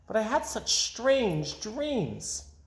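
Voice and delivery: high, slow and dreamy; girl tells her mother of strange dreams, slow dreamy. A narrator's reading. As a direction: float the words out. Amy dreamy.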